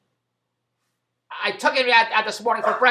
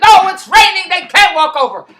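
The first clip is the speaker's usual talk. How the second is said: much higher, louder mock outrage; exasperated protest; 10 semitones higher, 14 dB louder than usual. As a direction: squawk that five is too late. Mother of the kids objecting.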